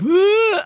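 high pained groan, imitated; a short mock groan of agony through a thin wall; retold groan. Heard through the inn wall, a man groaning in agony. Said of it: "this man groaning".

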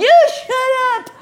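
very high, loud screech of a retort; exaggerated high-pitched comeback; mock yell back. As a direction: yell it over your shoulder, hammed up. Retells yelling back at cyclist.

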